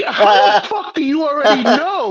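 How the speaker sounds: high, loud mock disbelief; shocked disbelief. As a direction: act floored she already knows. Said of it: "I'm like".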